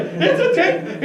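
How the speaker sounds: high, loud, drawn-out excitement; imitated giddy amazement; flipping out. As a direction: act out an angler losing it over his catch. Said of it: "he's flipping out".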